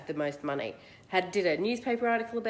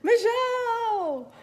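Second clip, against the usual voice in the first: high, stretched hammed-up MC announcement; mock announcer; 9 semitones higher, 5 dB louder than usual. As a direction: draw the winner's name out like a pageant host. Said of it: "So they go".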